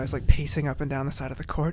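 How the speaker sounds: usual speaking voice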